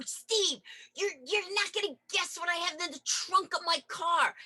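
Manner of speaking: high, gleeful teasing build-up; excited tease. Retold or imitated. Telling boyfriend Steve about her car trunk, excited. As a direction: tease him with a surprise.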